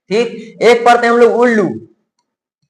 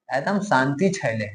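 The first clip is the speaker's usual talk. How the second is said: much softer and lower remark; quiet aside; 6 semitones lower, 12 dB quieter than usual. Real, not acted. Teacher noting the class has gone silent. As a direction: drop the voice to a soft aside. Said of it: "एकदम शांत हो जाता है".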